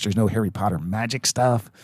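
normal talking voice

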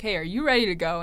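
usual speaking voice

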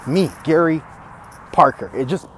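normal talking voice

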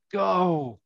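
restrained celebratory call; goal cry. Acted. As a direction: lift the pitch to announce a goal. Climax of the commentary.

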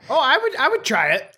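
high, eager "would" pushed up; keen to try it, voice lifted. Real, not acted. Eager reply about the tarantula.

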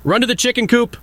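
mock urgent shout to run. A narrator's reading. Hen telling the mouse to run, high urgent call. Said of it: "She shouted to the noisy mouse".

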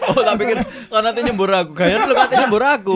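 laughing. Real, not acted. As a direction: crack up mid-sentence. Laughing while speaking.

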